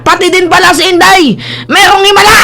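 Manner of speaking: high, exclaimed mock astonishment; mock amazement. Vlogger teasing about Inday's miracle, mock amazement. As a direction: act amazed, pitch climbing to the end.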